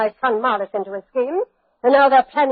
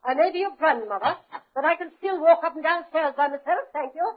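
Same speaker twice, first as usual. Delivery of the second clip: higher and softer, dismissive; haughty; 5 semitones higher, 6 dB quieter than usual. Acted. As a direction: brush him off with pride. Duchess rebuffs grandson's offer.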